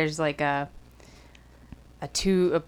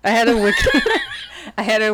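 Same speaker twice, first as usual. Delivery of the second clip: loud, breaking up into laughter; laughing; 4 semitones higher, 5 dB louder, faster than usual. Real, not acted. Daughter telling of her fall last night, laughing too hard to finish. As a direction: start the line and crack up. Cracking up about own fall.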